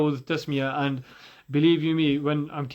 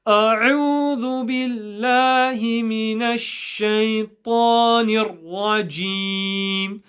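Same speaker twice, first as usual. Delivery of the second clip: higher, sustained chanting tone; Quranic recitation; 5 semitones higher, 3 dB louder than usual. Shown as a demonstration. Recites for the students.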